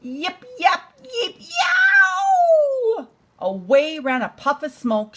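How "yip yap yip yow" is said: high, rising yelps into a loud yowl; coyote yelping howl. Acted. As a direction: yelp and howl like a burned coyote. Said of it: "all those sounds together".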